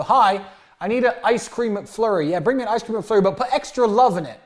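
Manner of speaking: cheeky drive-thru order. Retold or imitated.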